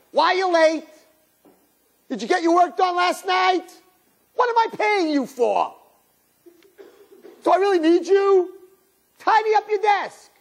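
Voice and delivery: high, sharp mock boss nagging the staff; scolding boss. Bad boss scolding late workers, high and sharp. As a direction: fire the questions like a grumpy boss.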